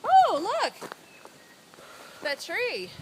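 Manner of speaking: sudden high excited call. Real, not acted. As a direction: point it out with a loud, high voice. Spots a fallen tree.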